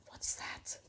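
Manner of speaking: hushed, breathy question; whisper. Real, not acted. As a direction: whisper it like a secret. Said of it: "whispering while reading".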